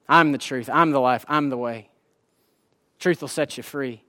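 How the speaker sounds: hushed solemn words of Jesus; soft, solemn quote. Acted. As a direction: say it gently, like a promise spoken close. Quoting Jesus to students.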